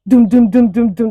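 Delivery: loud "doom doom doom" with no gaps; rapid-fire hits imitated. Vlogger mimicking a nonstop verbal attack, pounding dooms. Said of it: "That's how she was hitting it".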